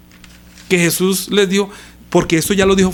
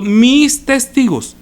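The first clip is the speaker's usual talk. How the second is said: "mis" drawn out high and slow; stress on MIS; 7 semitones higher, 4 dB louder, much slower than usual. Real, not acted. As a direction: hammer "mis", stretching the phrase slowly. Preacher repeats the key phrase emphatically. (énfasis alargado en "mis")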